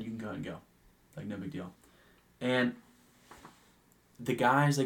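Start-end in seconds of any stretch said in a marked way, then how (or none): 0.0-1.7 soft, low, casual aside; discreet reassurance. Retold or imitated. Bartender relieves coworker at closing, quiet and low. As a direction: let them off quietly, no fuss.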